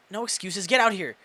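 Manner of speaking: loud, sharp command; stern order. Acted. Principal ordering Ben out from behind the curtain, stern and loud. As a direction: bark the order at him.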